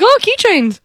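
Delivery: high-pitched, distracted little "ooh"; mock childlike delight. Daughter acts her distracted self during mom's fight, mock delight. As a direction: imitate a kid spotting something shiny.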